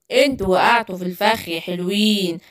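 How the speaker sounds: low, soft gloating villain voice. Acted. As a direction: savour the trap you sprang. Villain gloats over trap.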